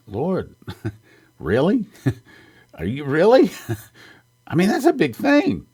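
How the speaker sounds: incredulous laugh, pitch climbing high; laughing disbelief. Retold or imitated. Preacher retelling his laughing reply to the Lord, amazed.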